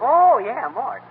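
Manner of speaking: sudden realization. Real, not acted. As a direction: it just hit him. Suddenly remembers the parrot.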